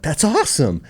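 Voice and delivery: high-pitched, delighted exclamation. Retold or imitated. Son reacting to dad's confession, delighted. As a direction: burst out with approval.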